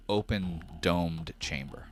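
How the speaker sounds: ordinary talk, nothing marked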